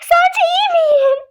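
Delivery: high, slow tearful question; mock tearful; pretend crying. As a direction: whimper it back. Tearful reply.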